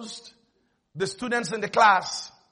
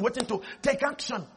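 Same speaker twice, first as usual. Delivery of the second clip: ordinary talk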